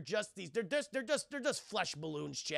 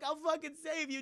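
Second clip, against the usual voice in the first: imitated desperate cry; 9 semitones higher than usual. Sonya calls to Jax being taken.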